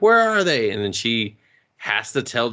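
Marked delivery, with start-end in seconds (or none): 0.0-0.7 higher, louder guard's demand; mock shout. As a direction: call out like a guard searching. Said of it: "they're like".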